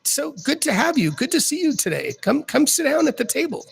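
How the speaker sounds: a high, cheery greeter eager to seat them; welcoming hostess voice. Acted. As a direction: coax the guests in with a high sugary lilt. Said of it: "you hear her say".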